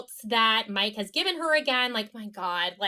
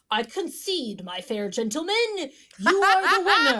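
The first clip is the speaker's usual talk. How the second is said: lightly hammed-up speech, swelling to a high, loud finish; mock-formal gentleman admitting defeat; mock gallant concession; 5 semitones higher, 3 dB louder than usual. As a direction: concede grandly, getting louder as you go.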